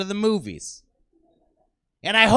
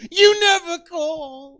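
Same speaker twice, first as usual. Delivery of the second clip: higher, louder, slow reproachful mother; guilt-tripping mother; 7 semitones higher, 6 dB louder, slower than usual. Retold or imitated. Comic imitating his mother guilting him, slow and reproachful. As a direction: whine it like a hurt mom. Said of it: "like my mother says".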